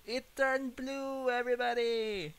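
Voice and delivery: high, loud, stretched-out exclamation; amazed shout after a laughing fit; excited cry. Real, not acted. Startled by colour change, laughing.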